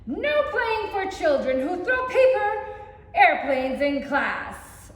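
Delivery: loud, stretched-out warning; stern loud scolding. Acted. Teacher scolding paper airplane throwers, loud and slow. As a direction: lay down the law, slow and loud.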